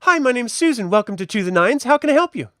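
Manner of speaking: high, bright-voiced young shop assistant; shopgirl Susan. Shop assistant greeting the adventurers, high and chirpy. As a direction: greet the customers sweetly, pitched well up. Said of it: "You see a young woman".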